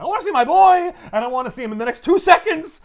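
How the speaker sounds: high, loud hammed-up shout; reading the riot act. Aunt demanding to see her boy, shouted riot act. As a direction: yell demands like an irate aunt. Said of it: "gives everyone in shield the riot act".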